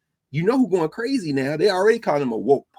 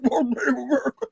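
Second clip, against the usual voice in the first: high quoted cry; 9 semitones higher than usual. Retold or imitated. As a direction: lift it high without pushing the volume. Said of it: "He said".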